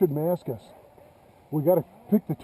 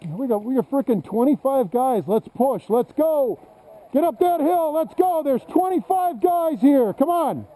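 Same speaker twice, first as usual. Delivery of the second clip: shouting commands; 8 semitones higher than usual. Real, not acted. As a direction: urge the squad forward, yelling. Rallying team up the hill.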